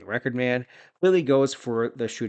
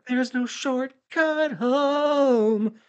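much higher, very slow dramatic voice; hammed-up promo quote; 13 semitones higher, 4 dB louder, much slower than usual. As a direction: stretch every word like a cheesy villain. Quoting promo line in voice.

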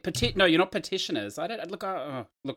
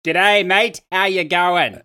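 loud, hearty Aussie g'day; big Australian hello; broad Australian greeting; 3 semitones higher, 7 dB louder, much slower than usual. Acted. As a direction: play up the Australian from down under. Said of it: "my brother from down under".

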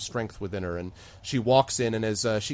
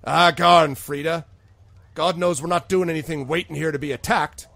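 loud, higher-pitched protesting bartender; exasperated bartender; 6 semitones higher, 8 dB louder than usual. Acted. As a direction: push back hard at the constable. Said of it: "Seth looks at her and he says".

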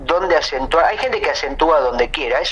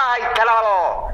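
high-pitched drawn-out mock howl; mock wail; 7 semitones higher than usual. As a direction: cry it out high, stretched and silly. Acts out an absurd name as wail.

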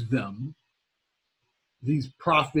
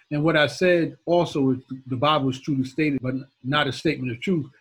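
ordinary talk

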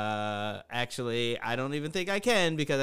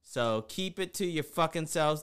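ordinary talk, nothing marked

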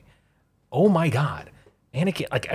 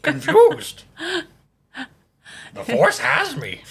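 very high put-on creature voice; Yoda; 3 dB louder, slower than usual. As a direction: pronounce it as a befuddled little sage. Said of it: "It's a good Yoda".

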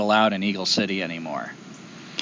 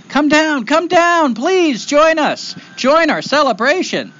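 high-pitched, louder calling out; beckoning call; 5 dB louder, slower than usual. Acted. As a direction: call them down to join the party, words drawn out. Crowd calls boy down to celebration.

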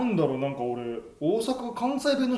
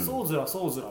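lightly voiced Izu dialect, lower and softer; 3 semitones lower, 6 dB quieter, faster than usual. Shown as a demonstration. Showing a friend how Izu locals talk, light Izu dialect. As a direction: give a low, soft hint of Izu dialect. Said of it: "伊豆とかはまただいぶ特殊で".

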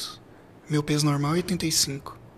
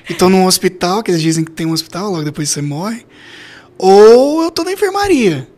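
excited; 2 semitones higher, 11 dB louder than usual. Retold or imitated. Laughing at thinking he'd died.

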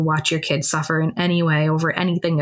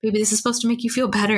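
put-on higher, fast, pleading coax to a child; hurried, higher-pitched coaxing; coaxing mom; 5 semitones higher, much faster than usual. Retelling how she pleaded with her daughter to keep the mask on.

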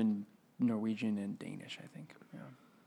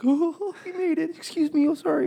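very high, loud mock-polite Minnesotans; hammed-up apologetic Midwestern niceness; nice Minnesotans; 5 dB louder than usual. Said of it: "like, nice people".

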